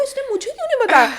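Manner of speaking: high, fast, laughing retold outburst; excited disbelief. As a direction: squeal it out half laughing. Retelling her own shock.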